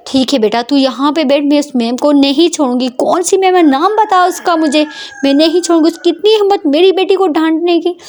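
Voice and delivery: high, indignant threatening mother; protective anger. Acted. Mother vows to confront the teacher, high and indignant. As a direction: vow she won't spare the teacher.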